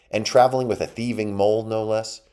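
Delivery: high, loud indignant accusation; scornful mouse. A narrator's reading. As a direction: challenge the trespasser with outrage.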